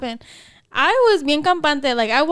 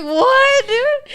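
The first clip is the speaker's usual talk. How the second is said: high, loud disbelief; incredulous outburst; 11 semitones higher, 4 dB louder than usual. Retold or imitated. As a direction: pitch jumps way up in disbelief. Retold disbelief at being sent back.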